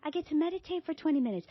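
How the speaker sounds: high, bright, falsely cheerful; mock chirpy cheerfulness; fake happy. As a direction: paste on a happy face. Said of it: "putting on my little happy face".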